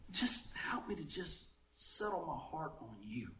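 slow, near-whispered plea to God; hushed prayer. Retold or imitated. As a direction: drop to a soft, slow murmur of prayer. Voices his own quiet prayer.

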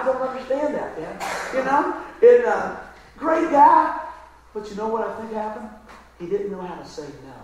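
ordinary talk

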